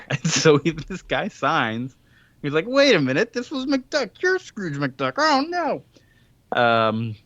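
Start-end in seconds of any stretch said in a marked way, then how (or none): 2.7-5.8 high-pitched dawning horror; hammed-up dismayed discovery; mock alarm. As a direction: act out a shocked realization, pitch jumping up. Retold chief realizing the trick.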